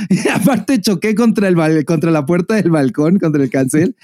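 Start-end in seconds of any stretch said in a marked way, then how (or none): none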